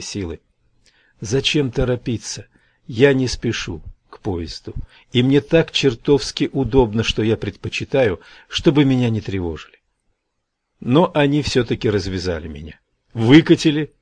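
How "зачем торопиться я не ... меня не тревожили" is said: plain reading voice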